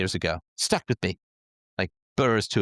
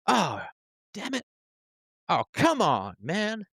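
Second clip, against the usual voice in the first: exasperated; 8 semitones higher, slower than usual. Acted. Acts out journalists' exasperation.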